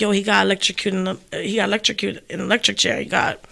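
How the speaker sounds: soft, broken-hearted telling; hushed and tearful; emotional, crying. Retold or imitated. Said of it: "I was on emotional mode".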